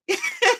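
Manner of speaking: a sudden high, bursting laugh. Real, not acted. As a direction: let a delighted laugh burst out. Laughs after "making me cry.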